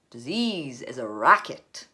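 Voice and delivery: loud, slow, forceful declaration; emphatic pronouncement. A narrator's reading. Father rails against Big Pharma, loud and emphatic. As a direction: declare it loudly, word by weighty word.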